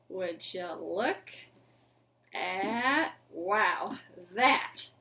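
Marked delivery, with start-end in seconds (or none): none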